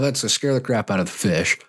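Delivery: ordinary talk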